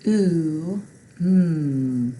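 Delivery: ooh held long and loud; drawn-out vowel sound. Shown as a demonstration. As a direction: stretch the ooh for the children. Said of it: "it has the ooh".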